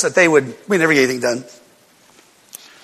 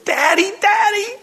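put-on dying child calling; 14 semitones higher, slower than usual. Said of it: "And he's going".

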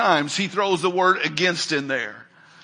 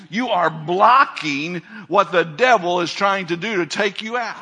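ordinary talk

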